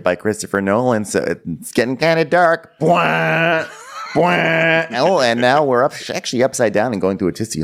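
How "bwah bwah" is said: mock movie-trailer bwah; booming cinematic sting, hammed up. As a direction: blare it like a trailer horn. Mock dramatic movie sound.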